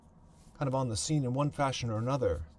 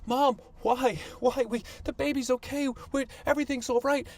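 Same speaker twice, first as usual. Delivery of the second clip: put-on high-pitched, pleading child's voice; kids pleading; 12 semitones higher than usual. Little girls begging their mother during a beating, high and pleading. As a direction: beg mom like a frightened little girl. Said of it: "and we're like".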